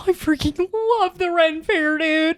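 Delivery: high, slow bro voice; gushing stoked dude. Retold or imitated. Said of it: "he's like".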